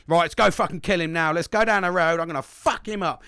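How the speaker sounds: rowdy acted-out call to arms; typical angry bloke. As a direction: act out a mate shouting for revenge. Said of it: "it's kinda like that".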